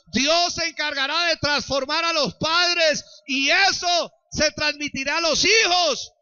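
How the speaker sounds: loud, high preaching shout; full-force preaching. Acted. Pastor blessing the congregation's families, shouting.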